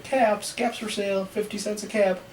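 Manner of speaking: high, singing-out street seller's call; calling out wares. A narrator's reading. Peddler calls as he went along, calling out.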